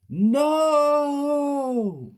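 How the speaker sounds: very high, loud, long scream. A narrator's reading. Children seeing the teacher shot, screaming. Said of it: "me and Ted screamed".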